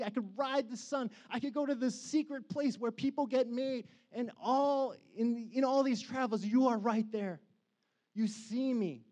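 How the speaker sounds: high, impassioned praise; fervent preaching. Acted. Preacher paraphrasing the psalm to the congregation, soaring and fervent. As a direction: pour it out to God, wistful and soaring.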